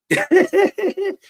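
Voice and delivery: sudden high laugh breaking through grief; a fond laugh bursting out mid-memory; real laugh. Real, not acted. Father laughing while remembering his late daughter. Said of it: "You see how he just laughed just now?".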